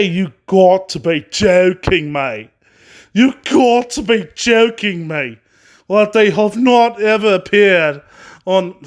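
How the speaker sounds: slow, put-on Australian accent; your best attempt at an Aussie accent, drawn out; hammed-up Aussie with a drop on "mate". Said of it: "that's the best Australian accent I got".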